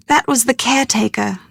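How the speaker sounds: ordinary delivery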